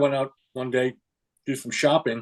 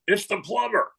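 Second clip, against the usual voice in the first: high parrot mimicking the plumber; 7 semitones higher than usual. Parrot answering the homeowner, high mimicking voice. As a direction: squawk it back as the parrot, pitched up. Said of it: "The parrot says".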